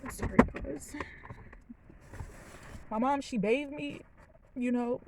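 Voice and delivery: choked up and apologetic; crying. Real, not acted. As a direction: fight back real tears while talking. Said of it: "I was not gonna cry".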